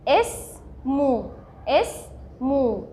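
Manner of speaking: "اسمو" very slow, a gap after the first syllable; teacher breaking "اسمو" into slow, distinct syllables; split into syllables. Shown as a demonstration. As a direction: sound out "اسمو" in two separate parts. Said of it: "فهنقولها ايه".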